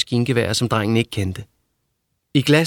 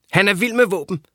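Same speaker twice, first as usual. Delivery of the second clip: higher, teasing character voice; lightly voiced higher teasing boy; Peckerhead's voice; 9 semitones higher than usual. A narrator's reading. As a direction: pitch him up and tease.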